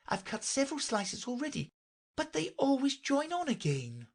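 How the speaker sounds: put-on high-pitched girlish character voice; young Alice. Alice chatting to the unicorn, high and girlish.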